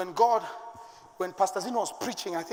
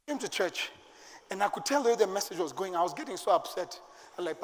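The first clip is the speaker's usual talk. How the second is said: usual speaking voice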